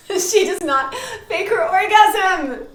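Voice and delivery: high, loud, drawn out through laughter; laughing exclamation. Real, not acted. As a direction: cry out the rule, cracking up. Repeats the rule while laughing.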